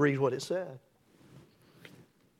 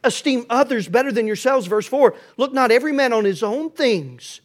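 loud, higher-pitched, emphatic sermon delivery; full-force preaching; 4 semitones higher, 9 dB louder than usual. Real, not acted. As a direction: preach it out forcefully to the whole church.

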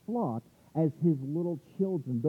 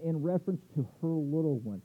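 ordinary delivery

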